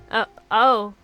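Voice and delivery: normal talking voice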